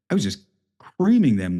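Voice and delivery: ordinary talk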